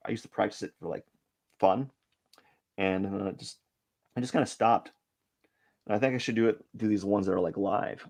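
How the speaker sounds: usual speaking voice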